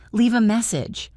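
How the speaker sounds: voicemail greeting; like a recorded message. Acted. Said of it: "his recorded voice said".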